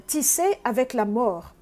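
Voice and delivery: ordinary delivery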